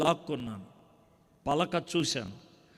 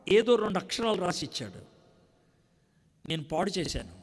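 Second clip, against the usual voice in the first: normal talking voice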